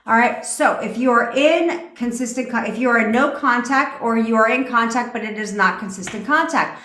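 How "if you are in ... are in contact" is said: ordinary delivery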